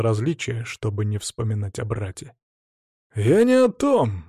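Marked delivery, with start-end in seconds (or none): 3.1-4.2 higher, louder slow scoff. A narrator's reading. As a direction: brush it off with a snort. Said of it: "хмыкнул Ярополк".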